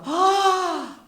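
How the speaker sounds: high, loud shocked gasp of dismay; parents' gasp. Retold or imitated. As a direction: gasp as if you forgot a birthday. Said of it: "You could hear Mom and Dad go".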